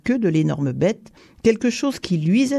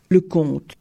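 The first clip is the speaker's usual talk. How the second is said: ordinary delivery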